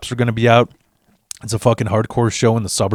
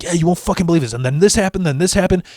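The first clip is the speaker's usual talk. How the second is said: flustered rambling; 6 semitones higher, faster than usual. Retold or imitated. Stressed rambling excuse to friend.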